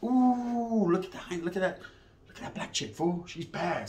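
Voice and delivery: soft, near-whispered ogling; hushed admiring. Retold or imitated. Speaker hushing to his homeboy about the girl, soft and hushed.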